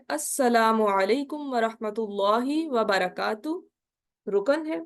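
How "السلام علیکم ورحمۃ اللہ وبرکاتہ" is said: very slow recitation of السلام علیکم ورحمۃ اللہ وبرکاتہ; slow full salam. Shown as a demonstration. Teacher repeating the full salam for the class, slow and clear. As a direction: recite it again slowly, each word whole. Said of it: "پھر سے ہم دیکھ لیتے ہیں".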